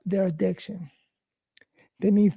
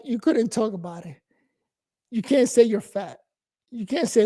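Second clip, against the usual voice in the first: ordinary talk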